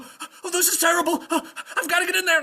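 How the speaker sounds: high, loud panicked fretting; frantic squire. Acted. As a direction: fret wildly, desperate to get inside.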